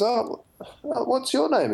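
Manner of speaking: high, startled demand; mock alarm. Friend asking the mysterious stranger his name, high and startled. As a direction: jump up in pitch, half alarmed.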